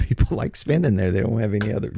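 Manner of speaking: normal talking voice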